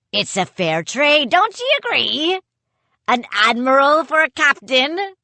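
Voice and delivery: a hint of a sly pirate captain; lightly voiced pirate gloating. A narrator's reading. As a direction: taunt them lightly. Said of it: "he laughed".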